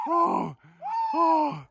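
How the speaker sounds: high, loud excited cry; excited shout. Real, not acted. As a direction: shout with pure adrenaline. Right after killing the ram.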